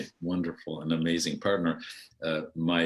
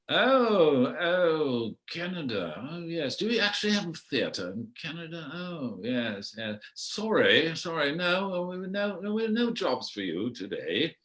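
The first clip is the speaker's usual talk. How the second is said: high, airy, condescending English voice; a mock posh brush-off, sorry but no jobs; snooty English theater type; 10 semitones higher than usual. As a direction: look down your nose at the Canadian. Dismissive London theater people.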